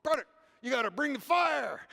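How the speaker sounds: fired-up preacher. Retold or imitated. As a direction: urge him like a fiery preacher. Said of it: "preach a little stronger".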